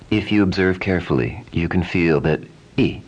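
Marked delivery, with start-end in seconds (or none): none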